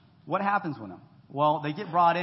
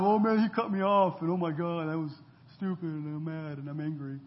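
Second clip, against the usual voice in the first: mock road rage. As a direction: act out losing it in traffic, not too seriously. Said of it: "I lose my mind".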